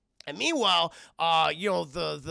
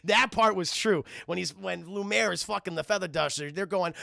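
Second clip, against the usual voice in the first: ordinary delivery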